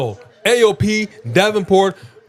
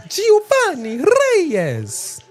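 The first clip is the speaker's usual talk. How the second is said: stretched high and slow; drawn-out high call; much slower than usual. Acted. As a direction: sing the name out slowly.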